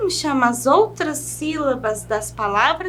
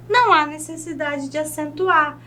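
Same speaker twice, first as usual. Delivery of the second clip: usual speaking voice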